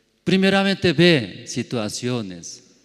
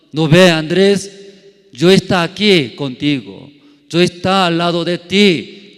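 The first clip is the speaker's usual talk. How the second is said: loud, higher, preaching as God speaking; 6 semitones higher, 10 dB louder than usual. Acted. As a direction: proclaim God's comfort with full force. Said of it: "¿cómo habla?".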